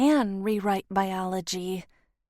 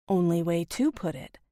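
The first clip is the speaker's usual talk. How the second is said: usual speaking voice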